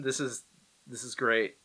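normal talking voice

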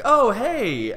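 high, loud gushy hello; gushing greeting. Acted. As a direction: burst in, delighted to spot a friend. Acting the chatty woman's greeting.

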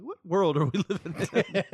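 laughing disbelief. Retold or imitated. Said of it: "you're like".

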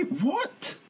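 sky-high baffled what; incredulous what. Real, not acted. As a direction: squeak it in total disbelief. Ranting about football baffled.

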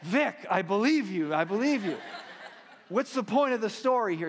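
amused disbelief, laughing out loud. Retold or imitated. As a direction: chuckle while humoring the friend. Pastor laughing at friend's tale.

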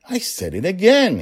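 high, loud, exasperated; comically vexed at his own slip; mock-frustrated outburst; frustrated with himself. A narrator's reading. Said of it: "He seemed frustrated with himself".

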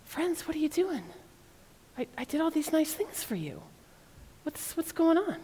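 teacher demonstrating a hurt God to the congregation. Shown as a demonstration. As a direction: plead gently, wounded. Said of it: "read it with a tone of hurt".

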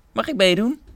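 eager question. Acted. Caterpillar asks to join.

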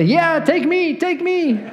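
high-pitched, pretend-eager pleading; mock eager volunteer. Preacher voicing would-be volunteers for persecution, mock eager.

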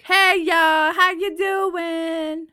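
loud, high, drawn-out Southern holler; exaggerated Southern-meets-Northeastern accent, big and slow. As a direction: belt out a stretched Southern greeting. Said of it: "mix of both Southern and, uh, Northeastern".

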